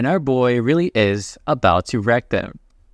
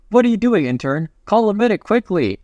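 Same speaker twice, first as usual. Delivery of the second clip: higher-pitched retold emergency call; mildly urgent, high-pitched quoted command; urgent order; 7 semitones higher than usual.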